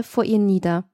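plain reading voice

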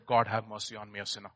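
soft, low, broken plea; humble plea. Acted. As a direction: murmur it in shame. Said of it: "beat his breast and said".

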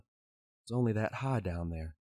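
ordinary delivery

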